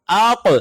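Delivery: echoing qalqala on اق; qalqala demo. Shown as a demonstration. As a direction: let the qaf in اق echo back out. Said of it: "آواز پلٹ کر واپس آتی ہے".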